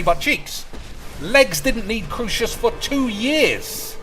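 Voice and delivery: high, slow whiny imitation of a viewer; a hammed-up doubter talking back; mock viewer objection. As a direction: parrot the nitpicking viewer in a silly voice. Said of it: "And I know you can say".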